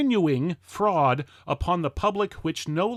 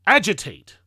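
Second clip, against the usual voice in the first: louder, ringing call to action; rousing cry; 6 dB louder than usual. Acted. Orator rallying the crowd to agitate, in a rousing cry. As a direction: hit it hard as the climax of the chant.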